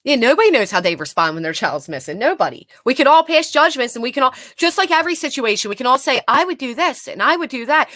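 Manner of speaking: ordinary talk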